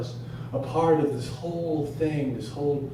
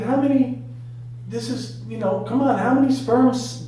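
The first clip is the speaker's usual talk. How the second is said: high, insistent protest; exasperated arguing; 9 semitones higher, 4 dB louder than usual. Acted. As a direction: argue back at the caller, pitch raised. Arguing with hospital caller.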